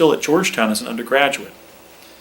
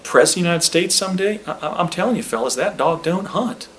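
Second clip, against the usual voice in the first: Southern Clinton voice, higher and folksy; Bill Clinton impression; 4 semitones higher than usual. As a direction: play young Clinton musing to the fellas. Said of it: "that was a little better".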